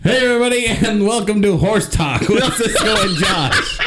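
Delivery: high, laughing TV host welcome; show host intro. Pretend. Mock horse show intro.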